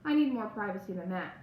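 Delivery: usual speaking voice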